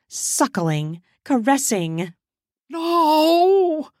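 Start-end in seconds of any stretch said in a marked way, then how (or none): 2.7-3.9 anguished no. Acted. Charles watches his friend abandon him, crying out. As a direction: shout it as your hope collapses. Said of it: "Charles called out".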